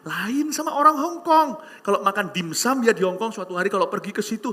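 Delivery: ordinary talk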